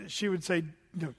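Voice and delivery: ordinary delivery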